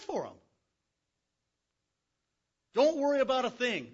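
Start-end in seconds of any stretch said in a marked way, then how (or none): 2.7-3.9 louder, higher, bold; confident reassurance. Acted. Young David boldly reassuring the king, loud. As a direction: declare it without a doubt. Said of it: "This was his reply".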